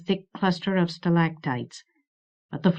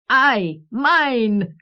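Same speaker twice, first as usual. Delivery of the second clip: high, gloating cackler's cry; shrill creature; 6 semitones higher, 5 dB louder than usual. A narrator's reading. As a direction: crow it shrilly like a malevolent rooster. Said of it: "It shrilled away into laughter again".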